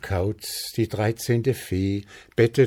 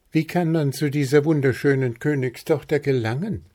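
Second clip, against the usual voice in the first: high, fast and loud little chirp; a small bird squeaking his question; piping bird voice; 9 semitones higher, 5 dB louder, much faster than usual. A narrator's reading. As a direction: peep it out eagerly like a tiny bird prince. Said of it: "piepste Zwitschi".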